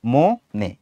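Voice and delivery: "ম" and "নে" as two separate beats; syllable by syllable. Shown as a demonstration. Teacher counting syllables, "ম" and "নে" said separately. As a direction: break "মনে" into detached syllables.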